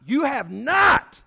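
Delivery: "you have not" slow, high and shouted, rising on "not"; emphatic reading. Acted. Preacher reading Hebrews to the church, stressing "not" in a slow shout. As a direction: hammer out "not" in a loud drawn-out rise.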